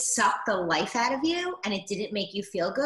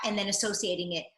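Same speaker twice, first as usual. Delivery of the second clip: normal talking voice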